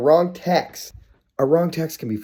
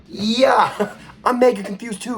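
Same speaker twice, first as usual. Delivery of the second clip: high-pitched, loud mock-excited yeah; much higher, laughing put-on reply; playful agreement; 11 semitones higher, 5 dB louder, slower than usual. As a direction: play along in a high voice with a laugh. Playing along with her confused text.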